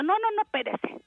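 high, hasty plea to hold on; retold alarmed protest. Laboring passenger stopping the hurried driver, retold alarm.

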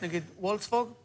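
ordinary talk

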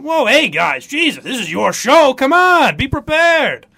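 a touch of mock panic, rising; hammed-up deflection; playing himself dodging the floor; flustered dodge. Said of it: "And I went".

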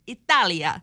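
mock Italian accent on the place name; lightly put-on Italian pronunciation. Host likens the cafe to Italy, Italian accent. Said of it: "how bad that Italian accent is".

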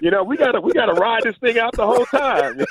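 high-pitched protest at a co-host; retold yelling. Ex-player retelling his objection to the co-host, mock yelling. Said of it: "you were yelling at him".

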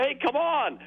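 put-on a kid begging for a break; pleading teen. Teen caller pleading with the old examiner, high and whiny. Caller voicing his teenage self.

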